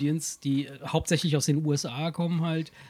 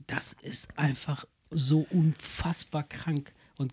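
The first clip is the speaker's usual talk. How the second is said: slow, hushed, almost whispered; awed whisper; 4 dB quieter, slower than usual. Real, not acted. Raving about Dave Chappelle to friends, whispered awe. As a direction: breathe it out in awe.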